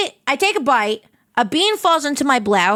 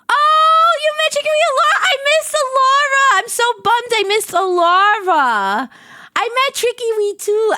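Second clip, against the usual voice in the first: excited; 11 semitones higher than usual. Retold or imitated. Mimics friend's excited reaction.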